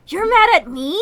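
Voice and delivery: indignant disbelief. Acted. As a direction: throw the words back at her.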